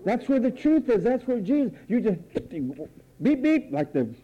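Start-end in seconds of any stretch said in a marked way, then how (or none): 3.2-3.7 high quick beep beep; high cartoon beep beep; mock roadrunner beep. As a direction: toot like a cartoon bird. Imitates Road Runner.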